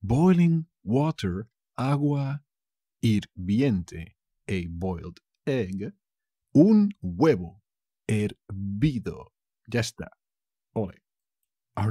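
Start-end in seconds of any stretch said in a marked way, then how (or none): none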